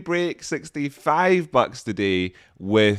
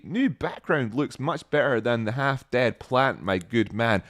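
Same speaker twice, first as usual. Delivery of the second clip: usual speaking voice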